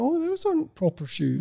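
high, softly sniffy aside; snooty disdain. Retold or imitated. Imagined snob judging someone's shoes, snooty disdain. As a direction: turn your nose up at it, high and quiet. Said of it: "turning your nose up at somebody".

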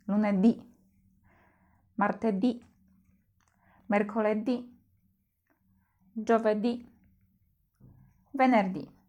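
Lunedì, martedì, mercoledì, giovedì, venerdì, each with the last ì stressed; weekday list with the stress on the final ì. Shown as a demonstration. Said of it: "akcent pojawia się na ostatniej samogłosce".

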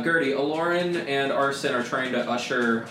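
ordinary talk, nothing marked

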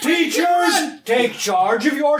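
shouting. Acted. Principal shouts at the fleeing crowd.

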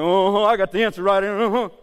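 loud, hammed-up rock-and-roll swagger. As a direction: croon it cockily like the King of rock. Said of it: "like [name], like".

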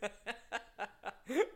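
high, staccato laughing fit. Real, not acted. Comic relishes the man's misery, high helpless laughter. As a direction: keep giggling, pitched high. Still cracking up.

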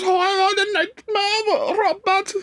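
put-on very high, slow old lady falsetto; Nine. Aunt explaining burnt cornflakes to the dad, high falsetto. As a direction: wail it like a fussing old aunt. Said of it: "And she goes".

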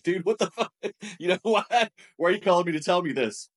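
high, laughing incredulous outburst at a friend; laughing disbelief. Retold or imitated. Retelling a friend's call, high laughing disbelief. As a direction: act floored and half-laughing. Retold disbelief at bad news.

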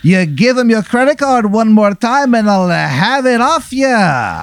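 high-pitched hammed-up Irish accent; Easy Irish. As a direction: insist in a terrible, high Irish brogue. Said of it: "this terrible Irish accent".

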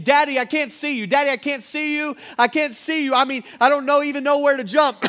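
high, loud cries of a frightened son; terrified boy. Acted. Boy trapped on the roof calls to his father, terrified. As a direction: call out to Daddy in rising panic. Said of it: "he's terrified, and, and he just keeps saying".

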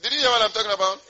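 sudden high, loud call to the crowd; loud challenge. Real, not acted. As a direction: raise your voice and demand a response. Breaks off to challenge the congregation.

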